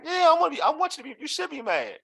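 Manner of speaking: high-pitched, indignant role-play; acted pissed off. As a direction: argue it as a ticked-off teammate. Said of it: "I'm pissed off".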